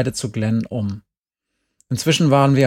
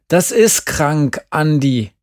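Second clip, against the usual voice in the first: slow, loud and higher retort; called out; 4 semitones higher, 6 dB louder, slower than usual. A narrator's reading. Glenn yelling back at Andi over the dead cat. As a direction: hammer the word ist, loud. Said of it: "rief Glenn".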